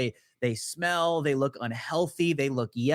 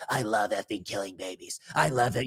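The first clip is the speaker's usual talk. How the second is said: demonic voice; 7 semitones lower than usual. Retold or imitated. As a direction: drop into a demon voice. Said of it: "voice changes".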